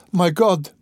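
a sharp high cry of alarm. A narrator's reading. Sonia hears her family is thrown out, high alarmed cry. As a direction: gasp it out, high and startled. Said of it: "cried Sonia".

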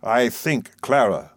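measured, understated gravity; mildly grave, deliberate address to a wife. A narrator's reading. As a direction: weigh the words solemnly. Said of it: "said Mister Murdstone in a low, grave voice".

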